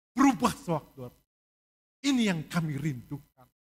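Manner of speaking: loud urgent preaching; preaching at full force. Acted. Pastor urging the church to soften hearts, loud preaching. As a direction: press the warning home.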